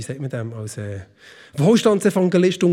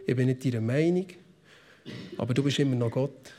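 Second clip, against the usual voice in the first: hushed, intimate plea to God; soft and low, almost praying under the breath; quiet prayer to God; 5 semitones lower, 7 dB quieter than usual. Retold or imitated. Worshipper quietly telling God he is still God. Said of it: "schreie ich manchmal zu Gott und sage".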